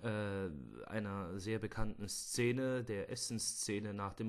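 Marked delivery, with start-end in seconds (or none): none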